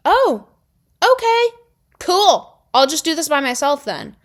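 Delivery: high, loud mock-hurt okay; sarcastic little okay then. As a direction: brush it off with sarcasm. Reacting to partner leaving.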